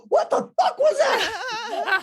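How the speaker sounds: high, slow, half-laughing shocked squeal; startled. Retold or imitated. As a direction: react like something just passed through you. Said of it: "Ghost tour guide Monica is like".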